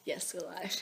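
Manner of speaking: whisper. Real, not acted. Whispering at start of recording.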